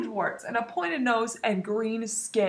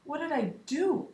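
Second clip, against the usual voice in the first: worried squeak; 7 semitones higher, 4 dB quieter than usual. Acted. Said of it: "I squeaked".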